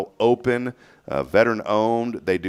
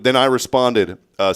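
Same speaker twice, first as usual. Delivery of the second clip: ordinary talk, nothing marked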